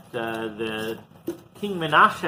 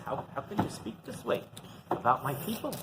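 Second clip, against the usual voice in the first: hushed rebuke; 10 dB quieter than usual. Acted. God rebukes the prophet.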